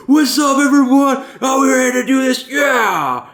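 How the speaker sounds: very high, loud mock hype shout; psyched up. Imitating a player who jumps into the lobby, psyched up. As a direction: yell like an overexcited player joining in. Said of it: "he's all psyched up".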